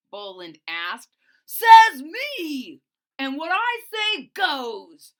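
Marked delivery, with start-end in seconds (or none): 1.5-5.1 very loud, high bossy roar; bully's bellow. Acted. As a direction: bellow it like the boss of the swamp. Bully dinosaur Tyrone voiced.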